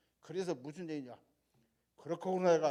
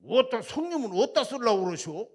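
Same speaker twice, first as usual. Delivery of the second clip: higher, loud Jeolla country question; Jeolla dialect; 6 semitones higher, 12 dB louder than usual. Retold or imitated. Landowner asking what the land is for, loud Jeolla dialect. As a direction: ask it the Jeolla way, big and curious. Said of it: "전라도 말에 솔찬히 잘합니다".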